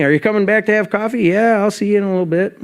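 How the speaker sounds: high, bright doorman greeting to each visitor; cheery greeter. Retold or imitated. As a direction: chirp it like a friendly doorman. Chatting with regulars at the door.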